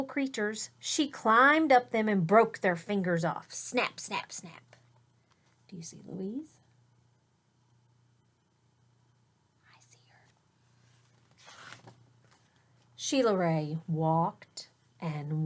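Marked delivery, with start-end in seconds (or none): 3.5-4.7 snapping sound. Acted. Sound effect in story. 5.7-11.9 whisper. Real, not acted. Whispered game with children.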